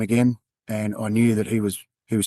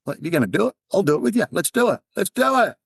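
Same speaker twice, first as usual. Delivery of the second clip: higher and rising, pushing yell; 10 semitones higher than usual. Retold or imitated. Cop yells at his friend on the cliff, daring him. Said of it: "I was just yelling at him".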